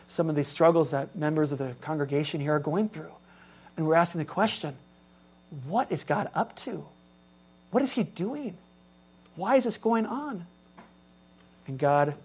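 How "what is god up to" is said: usual speaking voice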